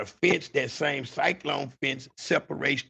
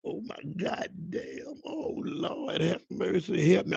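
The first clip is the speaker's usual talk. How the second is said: high, hushed, crying plea to God; hiding man's whimpered prayer; terrified prayer; 5 semitones higher, 4 dB quieter than usual. Retold or imitated. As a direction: beg the Lord under your breath. Praying while hiding.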